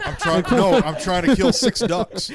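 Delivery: loud, laughing through the words; loud laughter over the words. Real, not acted. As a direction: blurt it out cracking up.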